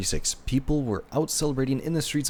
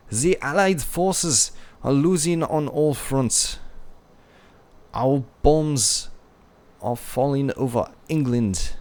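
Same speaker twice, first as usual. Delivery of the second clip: higher and louder, hammed-up German accent; bad German accent; 4 semitones higher, 4 dB louder than usual. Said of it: "my German accent is very bad".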